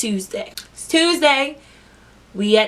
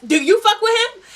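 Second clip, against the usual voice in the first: mock challenge; 12 semitones higher than usual. Repeating the girl's question.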